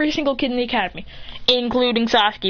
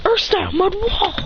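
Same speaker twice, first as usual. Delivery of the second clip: high anime move call; attack call; 9 semitones higher than usual. Acted. Hero raising a wall against attacks, high move call. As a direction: pitch up the technique name.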